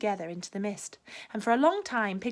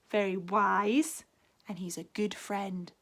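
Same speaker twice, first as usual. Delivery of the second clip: usual speaking voice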